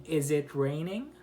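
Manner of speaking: "raining" climbing at the end; rising question intonation. Shown as a demonstration. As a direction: send "raining" upward as a question. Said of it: "the intonation goes up".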